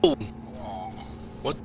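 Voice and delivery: usual speaking voice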